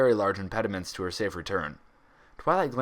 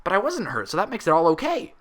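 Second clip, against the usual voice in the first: cheerful; 9 semitones higher, 5 dB louder than usual. A narrator's reading. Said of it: "Pinky reasoned cheerfully".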